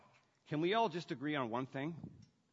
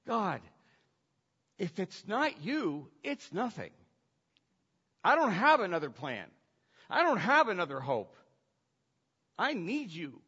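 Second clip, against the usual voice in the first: acted desperate prayer, pitched up; raised, urgent praying voice; earnest plea; 6 semitones higher, 6 dB louder than usual. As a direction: plead with God, leaning on each phrase. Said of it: "they get real and they say".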